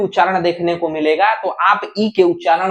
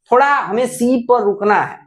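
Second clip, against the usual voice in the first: normal talking voice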